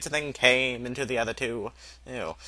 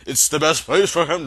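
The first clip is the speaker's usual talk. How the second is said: plain reading voice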